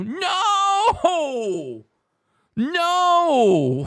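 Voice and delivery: high, wailed, long swooping no; dramatic disbelief. Acted. Host amazed at Lateral's wrong guess, dramatic wail. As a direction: cry it out like an incredible upset.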